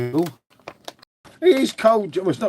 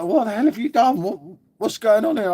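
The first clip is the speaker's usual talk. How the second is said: higher-pitched mock astonishment; retold mate's disbelief; 6 semitones higher than usual. Mate confronting him over botched work, mock disbelief. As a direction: act out a mate aghast at the state of things. Retells mate's shocked reaction.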